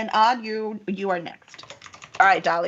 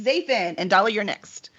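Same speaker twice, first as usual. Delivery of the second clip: ordinary talk, nothing marked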